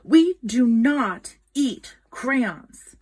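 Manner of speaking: ordinary delivery, nothing marked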